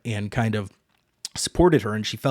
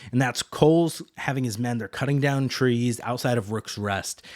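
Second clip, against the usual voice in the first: ordinary delivery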